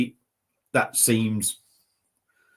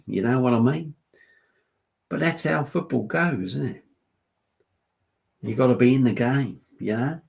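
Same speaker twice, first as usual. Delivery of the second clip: Ange Postecoglou. Pretend. Manager explaining a lost match, mock Australian accent. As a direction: put on a laconic Australian manager's voice. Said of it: "Ange Postecoglou, isn't it?".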